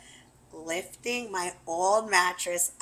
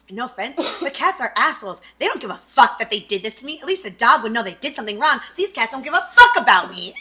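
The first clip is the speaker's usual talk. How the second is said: angry rant; 4 semitones higher, 11 dB louder, faster than usual. Retold or imitated. Ranting about the cats.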